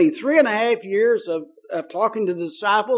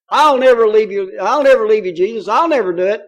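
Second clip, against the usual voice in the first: loud, insistent mock pledge; punchy retold promise, easing slightly at the end; braggadocious vow; 8 dB louder than usual. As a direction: imitate a hothead swearing loyalty.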